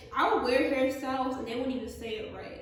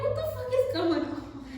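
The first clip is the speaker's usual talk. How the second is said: much higher and louder, exasperated disbelief; incredulous outburst; 12 semitones higher, 7 dB louder than usual. Retold or imitated. Driver seeing cars crash everywhere, loud disbelief. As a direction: throw it out in shock at the chaos.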